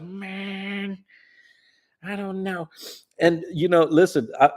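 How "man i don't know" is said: high, drawn-out sleepy dude voice; lazy surfer drawl, pitched up; Greg impression. Friend doing Greg for Barb, high and drawn out. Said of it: "Is that Greg or what?".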